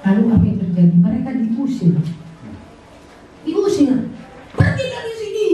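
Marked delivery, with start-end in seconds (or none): 4.6-5.4 mock angry eviction. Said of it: "ya, kira-kira begitu".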